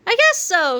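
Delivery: put-on high boyish voice; young Harold, pleased; kid Harold. A narrator's reading. Harold shrugging to his future self, kid voice. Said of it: "said Harold".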